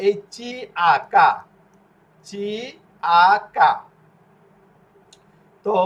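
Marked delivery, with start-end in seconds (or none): 0.3-3.7 চি, আ spelled apart, then কা with a hard k; hard C drill. Shown as a demonstration. Teacher showing when C sounds like k, spaced spelling drill ending কা. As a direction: sound out কা as "kaa" after the separate letters. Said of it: "চি অক্ষর উচ্চারণ ক এর মতো".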